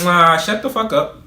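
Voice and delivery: high, dismissive ooh then the brush-off; sassy dismissal. Retold or imitated. Cutting off the teacher mid-lecture, sassy and dismissive. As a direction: wave her off with sass.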